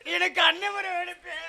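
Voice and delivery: mock crying. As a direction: whimper it in fake grief. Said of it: "இப்ப நீ ஏன் அழுகுற?". (போலியாக அழுது புலம்பி)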